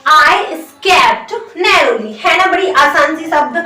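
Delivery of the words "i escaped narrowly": very slow, word by word dictation; slow model sentence. Shown as a demonstration. Teacher dictates the English sentence very slowly. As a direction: spell it out slowly for the class. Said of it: "इसे English में कैसे बोलेंगे आप".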